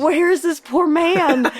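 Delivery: high, very slow, drawn-out worried call; searching worriedly. Retold or imitated. Searching the whole show for Don, high and slow. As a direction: stretch it out high like you're searching a crowd. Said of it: "I was just like".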